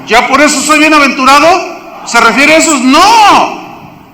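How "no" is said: emphatic no. Acted. Emphatic denial.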